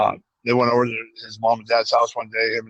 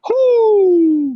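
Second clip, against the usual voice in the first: high hooting like an owl; owl hoots; 7 dB louder than usual. Retold or imitated. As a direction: hoot high and a bit louder. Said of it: "he's like".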